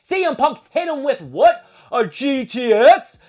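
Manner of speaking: high, loud excited yelling; hype yell. Real, not acted. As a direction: shout it like a fan losing it at ringside. Hyped recap of the finishing move.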